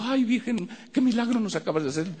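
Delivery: lightly mocked high pleading to the Virgin; mocking devotees. Family thanking the Virgin statue, mock devout. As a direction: mimic the family praising the statue. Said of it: "todos ahí con la Virgen ahí diciendo".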